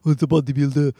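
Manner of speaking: exaggerated mock Austrian accent; Schwarzenegger. Shown as a demonstration. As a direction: ham up a thick Austrian bodybuilder accent. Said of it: "the impression of Schwarzenegger. You know that exaggerated one?".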